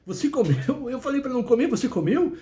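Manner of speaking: can't believe he disobeyed you; God scolding the man in shock; outraged disbelief. Acted.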